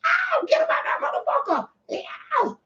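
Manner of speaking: piercingly high play-exorcist cries; mock exorcism. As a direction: squeal commands at the demon, hammed up. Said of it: "y'all witnessing a live exorcism".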